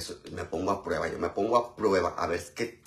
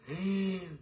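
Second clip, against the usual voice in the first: sharp high gasp of delight; surprised gasp; 9 semitones higher, 6 dB quieter than usual. Real, not acted. Surprised at offer to wash dishes. (jadeo de sorpresa)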